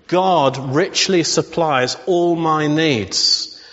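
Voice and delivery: slow, deliberate, leaning on richly; slow declaration. Shown as a demonstration. Pastor slowly declaring the promise to the congregation. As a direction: lay out each word of the promise.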